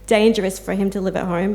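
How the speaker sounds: ordinary delivery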